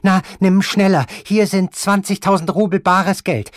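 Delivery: high, loud, imperious grandmother; imperious grandmother sending him off, high and loud; angry old grandmother. Acted. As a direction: snap at him like a furious old lady. Grandmother voiced in the story.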